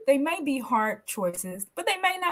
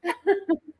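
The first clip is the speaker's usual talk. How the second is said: a short high real laugh; real light laugh; 8 semitones higher than usual. Real, not acted. Host laughs.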